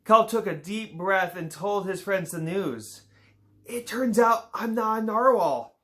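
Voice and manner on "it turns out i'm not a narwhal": Kelp's confession. Acted. Kelp confessing to friends.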